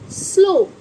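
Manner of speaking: स्लो said with a long o; pronounced slow. Shown as a demonstration. Teacher models स्लो as slo for her class. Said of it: "ऐसे ही जैसे".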